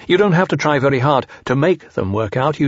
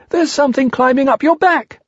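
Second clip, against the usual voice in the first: Piglet's little voice; 13 semitones higher, 3 dB louder than usual. Acted. Piglet warns Pooh.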